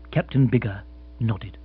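ordinary delivery, nothing marked

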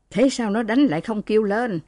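ordinary delivery, nothing marked

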